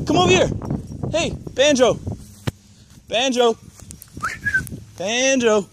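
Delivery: high coaxing dog call. Real, not acted. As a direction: call Banjo over, pitched high. Calling dog named Banjo.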